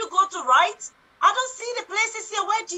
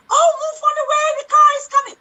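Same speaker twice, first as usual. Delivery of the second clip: louder panicked warning; terrified horror victim; 5 semitones higher, 6 dB louder than usual. Pretend. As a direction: yell about the oncoming car. Improvised horror scene, car danger.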